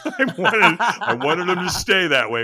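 laughing through the words. Real, not acted. Guest telling the host why he skipped the shower, laughing. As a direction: chuckle at your own stubbornness.

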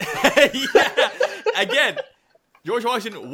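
high, loud laughter through words; words breaking into real laughs; cracking up at the joke about John Adams, real laughter; laughing. Real, not acted.

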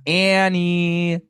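ANNIE sung out high and stretched; high, lingering call of "Annie"; drawn-out name inflection. Real, not acted. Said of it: "always say your name, like, with that afflection".

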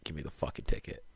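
very low, hushed threat; barely voiced, threatening demand; imitating a dad seething very quietly; menacing near-whisper. Dad threatens scared son.

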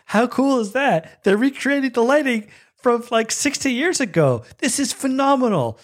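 very high-pitched fan gushing; way too excited. Retold or imitated. Fan marvelling at recreated lighting, way too excited. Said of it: "I'm way too excited".

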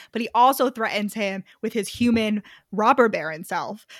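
ordinary delivery

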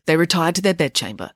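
usual speaking voice

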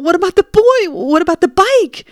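panicked, high questions; distraught. Retold or imitated. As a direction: beg for an answer. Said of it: "And I was like".

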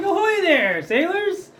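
high, loud hearty pirate hail; old sailor. Acted. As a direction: call out like a swashbuckling sailor. GM voices charismatic pirate greeting.

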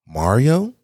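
high, questioning name call; rising, high-pitched call; imitated name call. Therapist calls his name.

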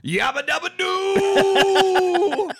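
high, loud, long-held whoop; cartoon catchphrase yell. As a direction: belt it out like a cartoon caveman. Joking cartoon catchphrase after pterodactyl burgers.

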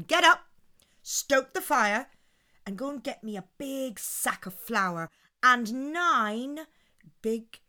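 loud, commanding wife's orders; bossy Una. Acted. Wife orders husband to fetch flour, commanding.

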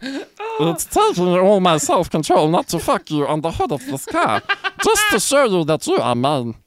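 high, goofy cartoon alien lover; high silly alien voice, barely holding back laughter; Jar Jar Binks. Possessive lover reading from the novel, high Jar Jar impression. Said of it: "to read in Jar Jar Binks' voice".

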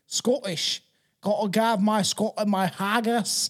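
failed attempt at a Scottish accent; bad Scottish accent. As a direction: try a badly faked, drawn-out Scottish accent. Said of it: "I still can't do it".

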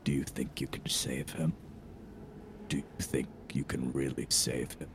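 low, hushed eerie whisper; creepy voice in the mind, very soft and low; sinister whispering voice. Acted. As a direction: whisper it like a voice inside the head. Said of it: "Then you hear".

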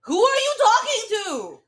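inner alarm voice. Retold or imitated. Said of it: "my fight or flight will be like".

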